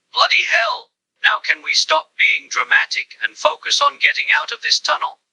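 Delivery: mildly exasperated groan of words. A narrator's reading. Duke cuts in on the drama in the tunnel, mildly exasperated. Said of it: "pipes up with an exasperated groan".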